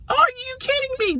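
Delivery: high, incredulous are-you-kidding-me; exasperated disbelief. Retold or imitated. Pet mom outraged at her picky dog, high incredulous. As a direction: pitch way up in mock outrage.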